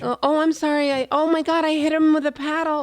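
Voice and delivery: high-pitched fake dismay; badly faked innocent alarm; feigned panic. Acting out an accident with a paddle, high feigned panic.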